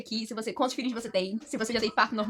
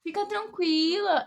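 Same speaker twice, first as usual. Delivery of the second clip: slow, higher, stretched-out reassurance; imitated soothing doctor; 5 semitones higher, much slower than usual. Doctor reassuring a woman in labour, slow soothing imitation. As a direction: play the doctor calming her patient. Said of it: "Eu ficava, ela".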